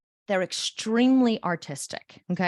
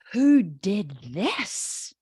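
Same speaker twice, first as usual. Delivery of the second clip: slow, drawn-out "who did this", rising pleasantly; delighted parent asking slowly who did it; good tone; much slower than usual. Acted. Artistic parents finding the child's wall drawing, slow approving tone. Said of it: "good tone or a bad tone".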